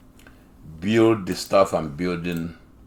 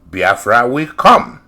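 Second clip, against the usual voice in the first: slow, loud build to "Biafra will come"; stress on COME; 5 semitones higher, 9 dB louder, slower than usual. Retold or imitated. Host reading a leader's quote to listeners, stress on COME. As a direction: COME drawn out, louder and rising. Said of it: "I want you to pick up on the word".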